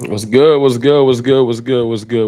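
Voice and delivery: calling out loud to the whole chat; loud repeated hype greeting. Real, not acted. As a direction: belt the greeting like a hype host. Opening greeting shouted to chat.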